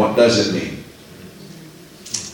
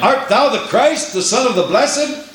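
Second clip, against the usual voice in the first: high priest questioning; 8 semitones higher than usual. Acted. Preacher voices the high priest.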